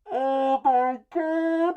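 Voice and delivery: very high, loud, drawn-out mock scream; overjoyed mother's scream. Mother greeting returning soldier, hammed-up overjoyed scream. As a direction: shriek it long and loud, hammed-up. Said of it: "the mother's like".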